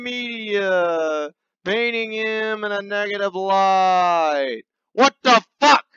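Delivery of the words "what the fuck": outraged shout. Acted. Outraged reaction.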